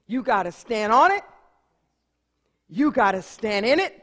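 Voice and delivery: loud, rising calls to stand firm; fervent preaching. Acted. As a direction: lift the room with it. Preaching the advice to the man.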